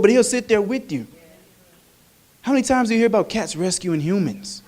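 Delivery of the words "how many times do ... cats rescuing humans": ordinary delivery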